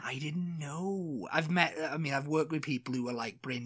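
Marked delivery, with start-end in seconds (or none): 0.0-1.3 very slow soft Welsh; Welsh accent. Retold or imitated. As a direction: stretch it out, broad Welsh. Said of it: "very exaggerated in the Welsh accent".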